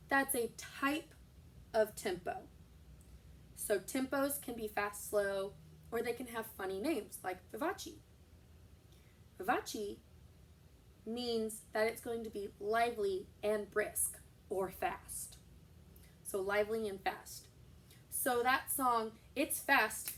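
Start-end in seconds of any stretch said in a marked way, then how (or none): none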